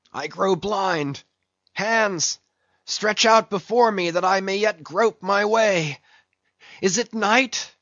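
high, anguished captain losing his sight; stricken Ahab. Acted. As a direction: cry out as you grope blindly. Ahab staggers, struck blind.

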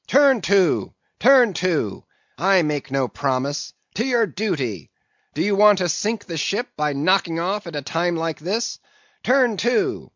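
a captain shouting down his crew; barked orders. Acted. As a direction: order them back to work, no arguing. Captain ordering mutineers back.

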